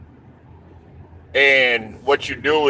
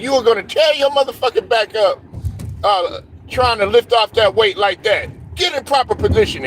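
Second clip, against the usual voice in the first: higher, retold coach's yell; 6 semitones higher than usual. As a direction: bark it like a coach scolding a player. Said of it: "coach was yelling at me".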